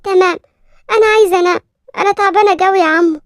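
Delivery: put-on a small girl complaining to her uncle, a little upset; scared little girl telling her uncle why she is upset. Narrator voices little girl.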